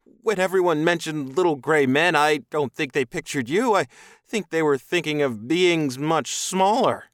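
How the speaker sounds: high, loud, agitated man; startled captive human. A narrator's reading. Captive man to towering alien, startled and high-pitched.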